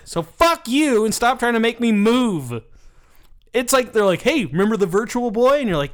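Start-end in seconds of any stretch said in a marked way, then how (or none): 0.1-2.6 high, drawn-out indignant rant; mock-furious couch gamer; exasperated outburst. Acting fed-up gamers' angry reply. 4.2-5.6 tag. Acted. Acting eager companies pitching gadgets.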